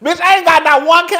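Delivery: ordinary delivery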